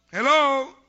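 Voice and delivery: shouted hello to the congregation; loud hello. Acted. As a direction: call out hello to wake the room.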